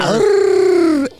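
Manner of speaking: high, loud singer's wail; metal scream. Pretend. Singer answers the question with a high metal wail. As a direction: let out a piercing metal shriek.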